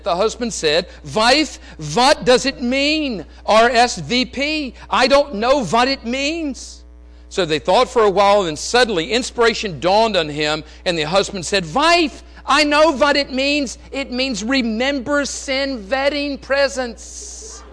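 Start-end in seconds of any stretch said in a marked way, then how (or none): 1.1-6.8 put-on slow, higher, louder thick European accent, w said as v; a puzzled husband in a heavy European accent. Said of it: "in his thick European accent". 11.7-17.6 put-on a puzzled husband in a heavy European accent; thick European accent. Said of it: "in his thick European accent".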